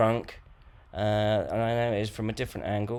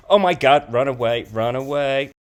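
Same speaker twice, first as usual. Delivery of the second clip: mock panic; 3 semitones higher, 8 dB louder than usual.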